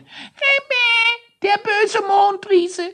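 very high, slow squeal; squealing Quietsch. Acted. Quietsch squeals in fear of the moon giant. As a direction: wail like a small scared creature. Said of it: "schrie unser Quietsch".

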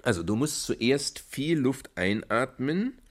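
slow, patient breathing instruction. Shown as a demonstration. Father teaches daughter the breathing trick, slowly. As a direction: spell out each step slowly. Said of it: "zeige ich dir noch mal einen Trick".

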